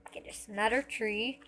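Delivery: ordinary talk, nothing marked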